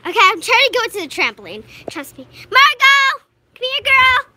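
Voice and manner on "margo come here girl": loud call to a dog. Real, not acted. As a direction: shout the dog's name across the yard. Calling dog from afar.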